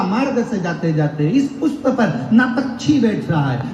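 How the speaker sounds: storytelling pitched high, half laughing. A narrator's reading. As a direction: tell the tale lifted and lively. Preacher tells story, raised pitch.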